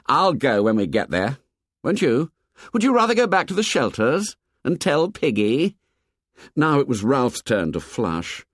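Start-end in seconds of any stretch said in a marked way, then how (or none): 0.0-5.8 heated comeback, rising higher; hot retort. A narrator's reading. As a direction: turn it into a challenge. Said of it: "said Jack hotly".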